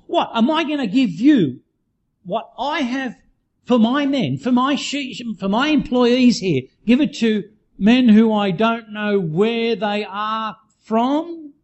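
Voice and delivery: high-pitched retold outrage, without real shouting; mock shouted scolding. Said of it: "he shouted at them".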